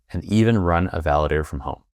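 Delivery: ordinary talk, nothing marked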